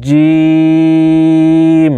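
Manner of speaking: "জিম" with a very long, level ee; তিন আলিফ টান. Shown as a demonstration. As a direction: hold the vowel of "জিম" for three measures. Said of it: "তিন আলিফ পরিমাণ টানতে হবে". ("জিম" তিন আলিফ লম্বা টেনে)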